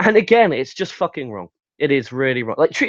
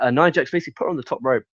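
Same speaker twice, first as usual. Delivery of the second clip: ordinary talk, nothing marked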